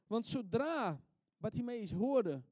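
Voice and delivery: usual speaking voice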